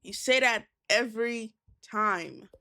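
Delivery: ordinary talk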